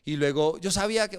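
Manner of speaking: ordinary delivery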